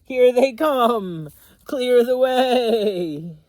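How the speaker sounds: high, loud neighing shout; horse yelling. Acted. Horse yells for the road to clear, high and loud. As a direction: bellow a warning to clear the road. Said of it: "Horse yelled".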